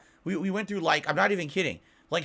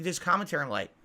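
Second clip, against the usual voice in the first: usual speaking voice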